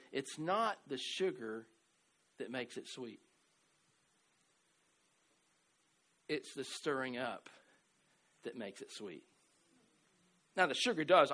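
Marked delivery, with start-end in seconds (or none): 0.1-9.2 slow, soft, deliberate saying; slow quote. Retold or imitated. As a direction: let each phrase land slowly and quietly. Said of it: "He said".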